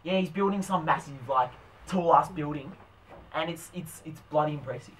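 put-on laid-back Australian bloke; mumbly mock bogan accent. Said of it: "we're going Bogan".

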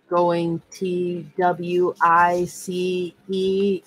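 spelled out letter by letter, slowly. Shown as a demonstration. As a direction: drag it out slowly, each letter. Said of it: "I'll do it slowly".

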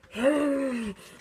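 loud drawn-out scream; startled cry. Pretend. Player attacked at the opened door, startled cry. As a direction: cry out as if jumped.

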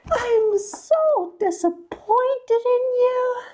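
very high, slow, stern shrieking; shrill scolding principal. A narrator's reading. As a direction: scold him like a naughty child. Principal scolds Mister Goose.

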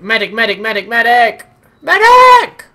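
desperate high yell for a medic; man down shout. Real, not acted. Downed, calling for a medic.

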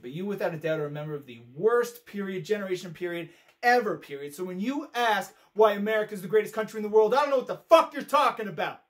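angry tirade. Acted. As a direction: let the accusation climb into a loud outburst. Acted rant building to outburst.

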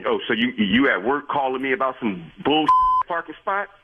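ordinary talk

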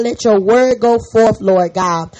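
usual speaking voice

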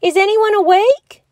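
high, loud call; shouting. A narrator's reading. Bear cub calling out to sleeping friends, loud. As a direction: shout into the silent caves. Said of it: "he shouted".

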